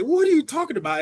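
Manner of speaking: high-pitched, incredulous comeback; exasperated retort. Retold or imitated. Tired racer to sister asking if ready, exasperated. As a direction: act out a flustered racer's protest.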